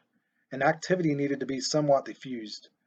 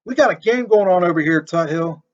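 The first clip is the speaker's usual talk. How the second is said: much louder, higher, shouted across the yard; yelling over the fence; 3 semitones higher, 8 dB louder than usual. A narrator's reading. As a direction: yell at the neighbor. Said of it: "yelled over his fence".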